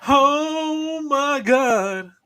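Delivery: very slow, high "Oh my God" of delight; awed first bite. Retold or imitated. Wrestler tastes tacos on imagined food review, slow awed delight. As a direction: savour it like the best bite ever. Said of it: "He's like".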